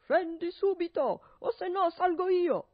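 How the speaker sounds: loud, high threatening bellow; furious shout. Acted. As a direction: yell the threat up the stairs. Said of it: "gridava con quanta ne aveva nei polmoni".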